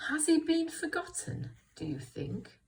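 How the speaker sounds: storybook question, starting high; questioning read-aloud. Shown as a demonstration. First plain reading of Bramwell's line.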